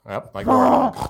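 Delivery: warthog voice. Pretend. Imitating a warthog.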